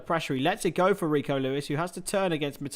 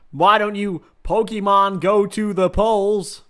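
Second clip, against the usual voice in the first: slow, loud, higher-pitched politician impression; Clinton; 4 semitones higher, 5 dB louder than usual. Streamer imitating a politician's poll plea, slow hammed-up delivery. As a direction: stretch it out like a stump-speech appeal. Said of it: "That's Clinton".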